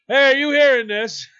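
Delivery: loud, high mock holler; booming retold shout for attention; calling out. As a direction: imitate a man yelling over to a stranger. Imitates man calling out in bathroom.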